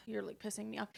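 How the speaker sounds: soft, annoyed aside after a sigh; quietly fed up. Retold or imitated. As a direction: say it under your breath, irritated.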